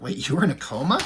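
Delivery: acted-out startled question, swooping up at the end; mock disbelief. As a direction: imitate someone taken aback, ending on a sharp rise. Girlfriend reacts to news of coma.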